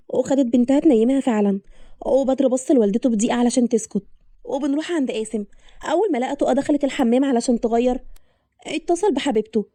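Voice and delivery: ordinary delivery, nothing marked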